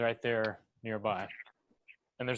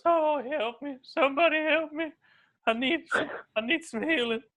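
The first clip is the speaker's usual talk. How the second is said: high, fluttery plea for help; fluttering cries for help. Acted. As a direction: squeak out a desperate call for healing. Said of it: "Simon goes".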